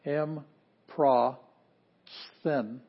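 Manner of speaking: "M, pra, sthin" in three beats with long gaps, "pr" kept together; syllable by syllable. Shown as a demonstration. Teacher showing the consonant division, "M, pra, sthin" said separately. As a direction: sound out "M, pra, sthin" slowly, piece by piece. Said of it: "we can pronounce that pretty easily together".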